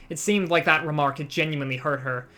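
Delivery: normal talking voice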